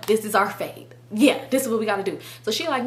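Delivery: normal talking voice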